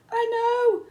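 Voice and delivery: very high and loud; panicked wail. A narrator's reading. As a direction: wail it in fear. Panicked boy answering.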